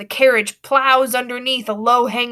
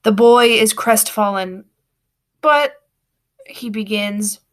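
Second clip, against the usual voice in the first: plain reading voice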